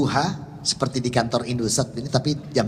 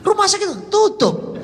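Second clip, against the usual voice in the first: much higher and louder, slowed right down; loud, slow repeat; 6 dB louder, slower than usual. Retold or imitated. Son repeating to his father, loud and slow. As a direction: spell it out loud for a hard-of-hearing father. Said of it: "saya bilangnya".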